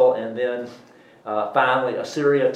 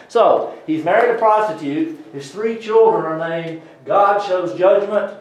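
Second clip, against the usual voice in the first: higher, louder southern preacher cadence; southern accent; 5 semitones higher, 5 dB louder than usual. Real, not acted. Explaining the prophet's symbolic family, southern accent. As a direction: slip into an emphatic southern accent. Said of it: "gone into my southern accent".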